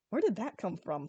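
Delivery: ordinary delivery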